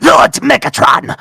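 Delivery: Starscream. Retold or imitated. Starscream addresses Megatron.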